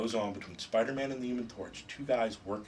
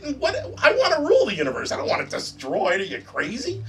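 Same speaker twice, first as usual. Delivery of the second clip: loud, high, laughing Loki rant; higher and louder, laughing through the outrage; exasperated Loki; 11 semitones higher, 10 dB louder than usual. Retold or imitated. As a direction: rant at Thor like a frantic trickster god. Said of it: "And Loki's like".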